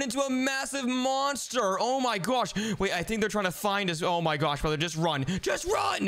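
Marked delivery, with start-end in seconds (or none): 5.4-6.1 high panicked shout; panicked urging. Pretend. Urging brother away from the monster, panicked shout. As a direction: shout it high as you flee.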